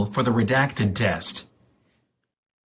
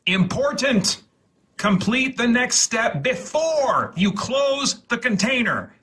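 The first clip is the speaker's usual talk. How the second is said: high, emphatic call-out of the warning; urgent warning; 9 semitones higher than usual. Real, not acted. Reading the kit's warning to the user, urgent and raised. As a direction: stress it like a real alarm.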